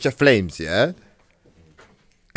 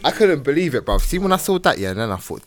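ordinary talk

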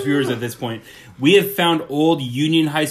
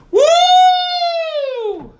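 high yodel whoop; 4 dB louder than usual. Shown as a demonstration. Yodel like Yahoo jingle.

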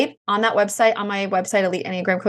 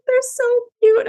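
put-on much higher, swooning child voice; gushing little girl; 3 dB louder than usual. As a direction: coo it like the teary daughter. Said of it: "And I just".

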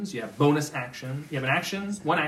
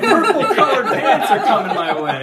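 laughing; 7 semitones higher, 10 dB louder than usual. Real, not acted. Laughing joke about purple pants.

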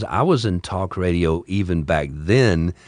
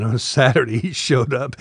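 ordinary talk

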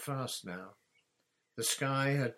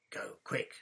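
hoarse, voiceless urgent rasp. A narrator's reading. Hagrid urging the hidden children away, hoarse rasp. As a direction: rasp it out with no voice left. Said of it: "he said hoarsely".

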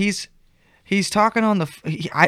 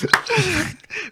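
high laugh; 14 semitones higher than usual. Real, not acted. Laughing at tweaker joke.

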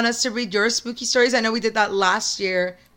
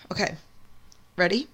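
ordinary talk, nothing marked

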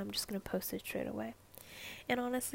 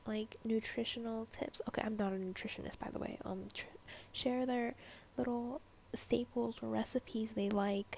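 ordinary talk, nothing marked

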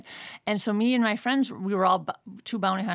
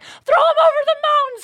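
high, loud wicked glee; yelling a mad plan; high loud mischievous shout; gleeful shout; 11 dB louder than usual. Retold or imitated.

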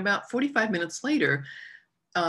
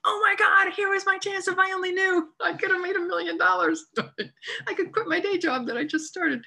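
high, wailing mock-anguish; high-pitched half-laughing lament over the missed photo; mock despair; 13 semitones higher than usual. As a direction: ham up the lost million. Mock regret at lost fortune.

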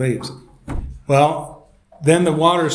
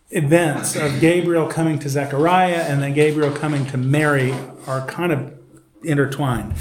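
usual speaking voice

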